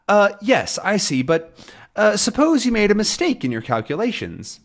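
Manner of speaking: high, fussy detective voice; high Thompson voice. Acted. Thompson questions Captain's calculations, high and fussy. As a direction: pitch it up like a prim detective.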